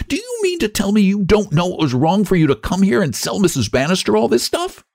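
high-pitched, outraged questioning; scandalized woman scolding a child; indignant scolding. A narrator's reading. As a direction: demand an answer, pitch held high.

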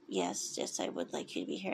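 mumbled low under his breath, barely voiced; bury the words, half whispered and embarrassed; muffled mumble. The shy Chief mumbling a reluctant yes to Tien, muffled whisper. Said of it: "you were talking very muffled".

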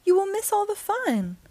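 high, pleading voice; Frog's dismayed protest; crying out in protest. A narrator's reading. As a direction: plead high with a friend not to miss out. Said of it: "cried Frog".

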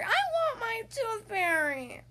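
stomping whine. A narrator's reading. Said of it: "stomping my foot".

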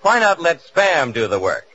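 ordinary talk

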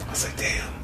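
whispered, unvoiced. Uncle beaten by his nephew, whispering. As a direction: breathe it out in defeat.

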